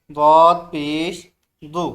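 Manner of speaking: slow, loud drilled syllable; teacher's loud slow recitation. Shown as a demonstration. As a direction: call it out loud for the class. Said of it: "ज़ोर से बोलें".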